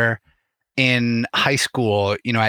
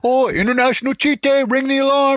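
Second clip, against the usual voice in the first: mock alarm tease. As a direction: ham up the annoying friend. Mocking friends' cheat day joke.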